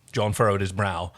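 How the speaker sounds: ordinary delivery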